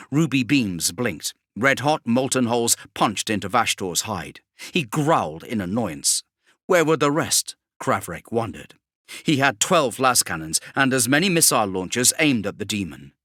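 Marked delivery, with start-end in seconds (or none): none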